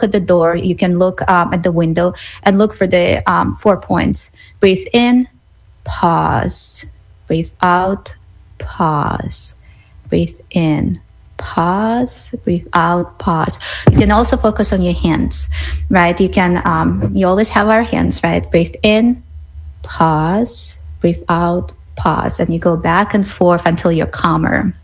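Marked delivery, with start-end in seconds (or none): 4.6-13.6 slow cues with pauses between; guided breathing. Shown as a demonstration. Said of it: "guides a breathing exercise". 18.5-22.4 slow cues with pauses between; guided breathing. Shown as a demonstration. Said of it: "guides breathing again".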